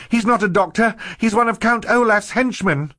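high, loud cry of alarm; girl's shout. A narrator's reading. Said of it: "Violet shouted".

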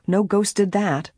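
ordinary delivery

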